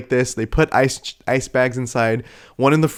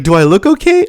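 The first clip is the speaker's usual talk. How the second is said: high, loud mock indignation; exasperated retort; 8 semitones higher, 6 dB louder than usual. Dazed donor answering the lady, loud high exasperation. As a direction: retort in disbelief, pitched up.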